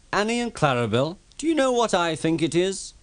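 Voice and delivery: high, teasing little engine; cheeky Thomas. Acted. Thomas teasing the coaches about Gordon, high and cheeky. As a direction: tease him with a sly grin.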